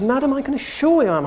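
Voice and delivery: fast, high English-gentleman retort, acted; mock-indignant in a proper English accent; best English accent. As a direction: protest grandly in your best English accent. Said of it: "in my best English accent".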